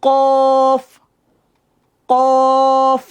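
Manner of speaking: কফ from deep in the throat; কফ with a deep Arabic qaf. Shown as a demonstration. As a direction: push কফ from far back in the throat. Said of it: "কফ একটু ভিতর থেকে আসবে আওয়াজটা".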